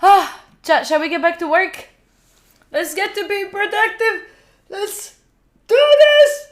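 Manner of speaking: high, bright pep-up to chat, ending in a loud cry; excited rally. Real, not acted. Streamer rallying chat to get back to work, excited. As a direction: pump up the chat to get going.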